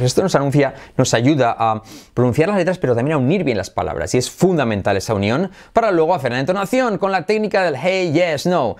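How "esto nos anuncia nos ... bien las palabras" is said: ordinary talk, nothing marked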